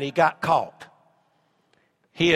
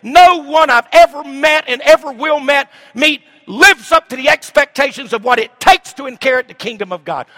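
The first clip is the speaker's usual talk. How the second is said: full-force preaching; 7 semitones higher, 8 dB louder than usual. Acted. Pastor preaching hard to the congregation, booming. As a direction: thunder it from the pulpit.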